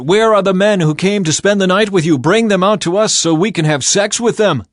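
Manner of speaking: loud, high, jeering demand; shouting from the street outside the door; angry mob shout. A narrator's reading. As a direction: yell it up at the house as a crowd. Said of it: "They shouted to Lot".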